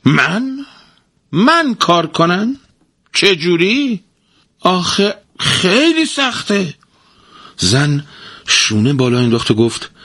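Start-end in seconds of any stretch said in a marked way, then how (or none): 0.0-6.7 high, slow, whining protest; a lazy husband whining about work; whiny, upset. A narrator's reading. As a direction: moan like a spoiled lazy man. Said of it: "با ناراحتی گفت".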